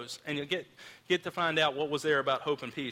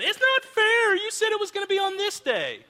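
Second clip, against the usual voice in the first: put-on high, loud whining child's voice; whiny kid protest; 6 dB louder than usual. Kid complaining to dad that it's unfair, whining high and loud. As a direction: whine it like a cheated little kid. Kids complain about wrong count.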